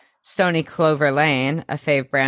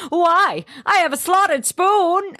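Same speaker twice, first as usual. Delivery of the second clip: whiny pleading; 14 semitones higher, slower than usual. Retold or imitated. Volunteer protesting the soup kitchen, very high whine. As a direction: squeal it like a hurt teen. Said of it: "And she's like".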